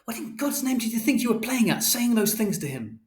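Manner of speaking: high-pitched accusation without much volume; angry mother shouting. A narrator's reading. Mother confronting Father over his lies, high-pitched shout. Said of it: "And Mother shouted".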